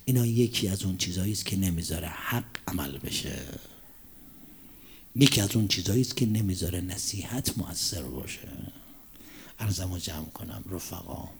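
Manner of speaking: soft, whispered, slowed pulpit voice; hushed sermon close. Real, not acted. Preacher closing the sermon before mourning Imam Hussein, hushed. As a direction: draw them in with a near whisper.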